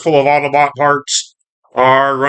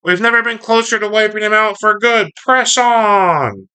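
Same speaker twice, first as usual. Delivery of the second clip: high-pitched cartoon villain rallying cry; Starscream; 9 semitones higher than usual. Starscream urges his wingman to press on, high and commanding. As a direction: push it high, stretching the final command. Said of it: "Starscream's going".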